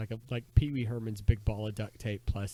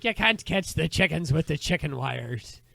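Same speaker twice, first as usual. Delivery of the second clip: slow, loud, high Scottish scolding; Scottish uncle; 5 semitones higher, 7 dB louder than usual. Acted. Uncle scolding the boy about chickens, loud Scottish accent. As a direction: drag it out in a hammy Scottish accent. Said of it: "Now he's half Scottish".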